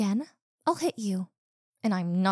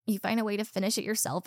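ordinary talk, nothing marked

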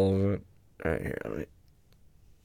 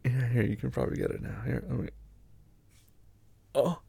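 ordinary talk, nothing marked

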